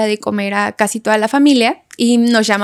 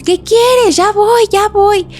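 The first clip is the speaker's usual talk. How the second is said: calling out; 10 semitones higher, 4 dB louder, slower than usual. Retold or imitated. Friend calling down from upstairs.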